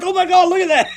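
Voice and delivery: very high, loud amazed exclamation; excited awe. Retold or imitated. Likening the reaction to seeing a bright meteor, thrilled exclamation. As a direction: exclaim like spotting a once-in-a-lifetime sight. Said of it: "like you're seeing something once in a lifetime".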